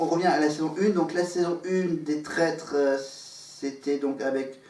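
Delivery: usual speaking voice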